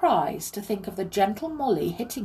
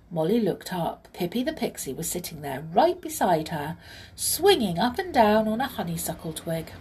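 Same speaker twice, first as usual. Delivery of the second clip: usual speaking voice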